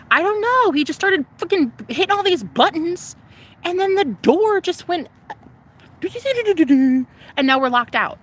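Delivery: high, loud whiny excuse; mock ditzy girlfriend. Girlfriend caught in the hallway, high flustered whine.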